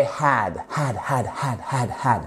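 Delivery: "had" repeated with a clearly breathed H; aspirated H. Shown as a demonstration. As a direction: drill "had" softly, puffing each H. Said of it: "On fait bien notre H ici". (« had » répété doucement avec un H bien expiré)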